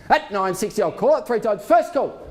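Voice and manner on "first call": calling out. Real, not acted. Auctioneer calling first call.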